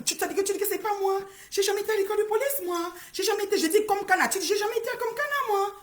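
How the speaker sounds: high mocking voice quoting her rival; mocking imitation. Streamer mimicking Mary's claim in a high mocking voice.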